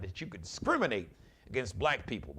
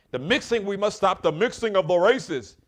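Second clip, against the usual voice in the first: higher, louder put-on Hungarian accent; mock Hungarian accent; 9 semitones higher, 13 dB louder than usual. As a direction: ham up Orban's Hungarian accent, declaring it loudly. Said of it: "with this funny ass acc-, accent".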